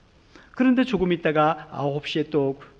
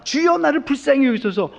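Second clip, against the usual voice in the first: pleading Bartimaeus; 8 semitones higher, 5 dB louder than usual. Acted. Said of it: "바디메오가".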